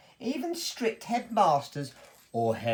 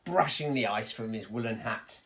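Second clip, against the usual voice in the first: ordinary delivery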